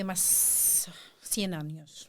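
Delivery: whispered confession, no voice. Real, not acted. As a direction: breathe it out like a secret prayer. Confessing prayer for long life.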